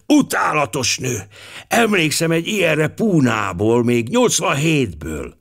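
put-on high, crotchety old gentleman; aged passenger. A narrator's reading. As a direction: grumble like an old colonial planter. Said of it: "folytatta az agg utas". (magas hangú, zsörtölődő öregúr)

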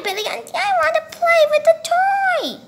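ordinary delivery

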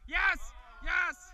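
loud high cheer; excited shout. Real, not acted. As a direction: cheer it out in triumph. Celebrating a play.

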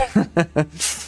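short real chuckle; amused chuckle. Real, not acted. Speaker laughing after asking if the point is clear, amused chuckle. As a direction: laugh briefly at your own rant.

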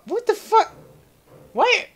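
high-pitched bewildered protest; baffled exasperation. Real, not acted. Gamer not getting why he is back, exasperated high voice. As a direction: whine in disbelief at the game.

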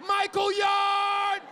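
embarrassing shout. Retold or imitated. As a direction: shout it across the schoolyard. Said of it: "I was like".

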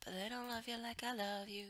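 ordinary talk, nothing marked

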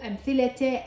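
أمثلة said amthilate, final te; voweled t ending. Shown as a demonstration. Teacher showing the spoken t, أمثلة ending in te. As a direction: end أمثلة on a clear te. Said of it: "هنا لفظتها تاء".

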